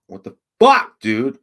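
sudden loud outburst at the computer; real exasperated shout; angry yell. Real, not acted. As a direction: yell it out in frustration. Frustrated streamer yelling at computer.

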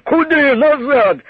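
loud, high barked order; fierce shout. A narrator's reading. Soldier stopping the nun, fierce loud shout. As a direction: stop her with a furious bark. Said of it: "лютим окриком".

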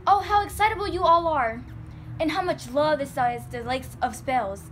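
exclaiming. Acted. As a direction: sigh over them all at full voice. Exclaims over everyone's passions.

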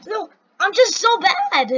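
loud, high self-reproach; exasperated outburst. Real, not acted. Player blaming own bad skills, exasperated outburst. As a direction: burst out in frustration at yourself.

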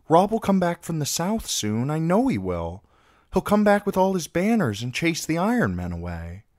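put-on high, insistent boy's voice; hopeful young boy. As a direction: insist with a child's certainty.

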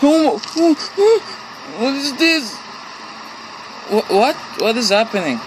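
high panicked oh-oh-oh, then confused questions; startled, voice jumping way up; frightened yelps. Real, not acted. Gamer spooked by the basement, high frightened yelps. Jump scare in horror game.

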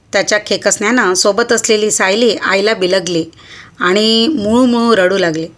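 usual speaking voice

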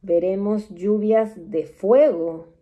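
slow, grave oracle pronouncing the end of days; solemn prophecy read with long pauses; doom-laden prophecy. Acted. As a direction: intone each line like a solemn prophet. Reads apocalyptic prophecy aloud.

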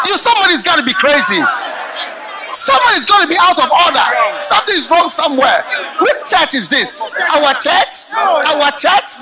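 loud, high laughing rant against lazy pastors; mocking shout. Real, not acted. Preacher rants about lazy pastors.

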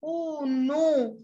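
high cry of no. Acted. Lost bee answers the elephant, high cry. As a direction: wail it like a lost little bee. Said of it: "cried little bee".

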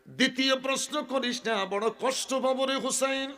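high, pained pleading; anguished plea. Acted. Prophet begging Husain not to ask, pained plea.

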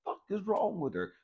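exasperated complaint climbing high; retold outburst, pitch rising to the end; mad at God. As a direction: act out blaming God. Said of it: "but I'm mad at God".

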